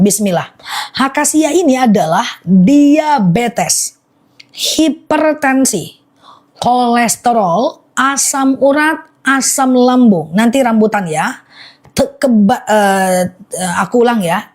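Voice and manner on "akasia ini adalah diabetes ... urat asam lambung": very slow, deliberate listing; slow repeat. Real, not acted. Seller repeating the list for live viewers, very slowly. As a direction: draw out each item, pausing between them. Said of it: "Aku ulang pelan-pelan".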